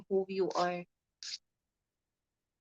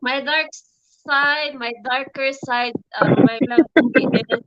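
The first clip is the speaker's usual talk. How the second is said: loud; 3 semitones higher, 10 dB louder than usual. Real, not acted. Loud reply to the visitor.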